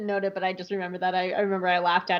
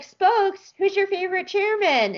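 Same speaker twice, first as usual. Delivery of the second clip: high, cooing voice for the cat; talking to the cat; 9 semitones higher, 3 dB louder than usual. Retold or imitated. Asking her cat Spokes a question, high and cooing. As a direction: coo it up high like baby talk to a pet. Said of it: "I'd be like".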